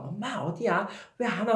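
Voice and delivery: high little girl's wail, imitated; a crying daughter calling for mom; crying child. Daughter on the phone crying for mom, imitated high wail. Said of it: "막 엉엉 우는 거예요".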